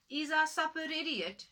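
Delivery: a hungry wife calling for supper; impatient wife. Acted. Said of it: "she asked the husband".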